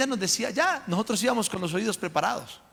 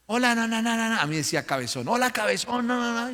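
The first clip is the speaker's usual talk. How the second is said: lightly voiced cranky grandmother, a touch higher; mocking grandma voice; 7 semitones higher than usual. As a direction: tease her rude dismissive tone. Abuela grosera imitada por el nieto. (imitando a la abuela gruñona, un poco más aguda)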